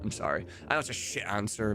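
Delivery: ordinary delivery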